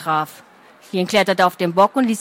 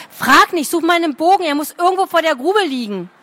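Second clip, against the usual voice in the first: put-on urgent girl; 6 semitones higher than usual. A narrator's reading. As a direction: hurry him along in alarm.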